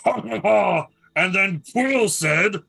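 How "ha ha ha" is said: loud, high, booming villain laugh. A narrator's reading. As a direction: boom out a gloating laugh. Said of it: "you hear a very loud booming voice going".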